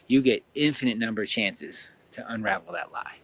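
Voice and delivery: usual speaking voice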